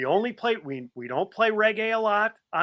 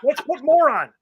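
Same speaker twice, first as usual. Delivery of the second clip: gleeful quote; 10 semitones higher, 6 dB louder than usual. Retold or imitated.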